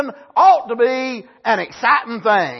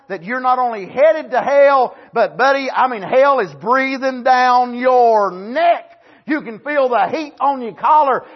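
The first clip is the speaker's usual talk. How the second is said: louder, slow hammering warning; fervent warning; 3 semitones higher, 5 dB louder than usual. Acted. Preacher warning sinners of hell, loud and slow. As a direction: bear down on them.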